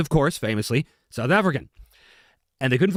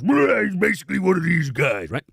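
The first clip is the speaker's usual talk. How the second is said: higher, growled villain voice; bad guy; 4 semitones higher than usual. Shown as a demonstration. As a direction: growl like a cartoon heavy. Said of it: "the guy was playing was".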